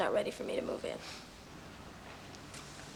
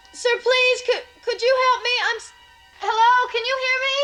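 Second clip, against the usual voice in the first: panicked pleading; 6 semitones higher, slower than usual. Acted. Trapped, pleading for help.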